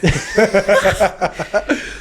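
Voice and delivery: high, loud burst of laughter; real laugh. Real, not acted. Laughing as the punchline nears.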